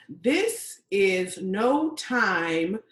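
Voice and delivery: slow, loud proclamation; emphatic post. Acted. Woman reads out her social media post, slow emphatic declaiming. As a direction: declaim it like a slogan, word by word. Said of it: "I posted".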